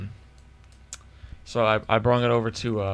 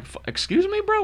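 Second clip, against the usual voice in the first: high-pitched, incredulous "excuse me"; mock-offended, pitched up sharply; retold indignant challenge; 3 dB quieter than usual. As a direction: challenge him in disbelief. Retold challenge to rude salesman.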